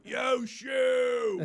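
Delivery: put-on loud drunk friend yelling from the aisle; drunken holler. Drunk friend heckling the comic, hollering. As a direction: holler it across a theatre. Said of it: "Yeah, hollering".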